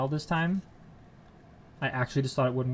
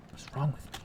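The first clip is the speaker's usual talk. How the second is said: normal talking voice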